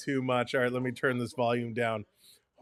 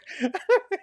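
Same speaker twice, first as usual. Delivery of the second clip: high squeal of laughter. Real, not acted. Laughing at a joke.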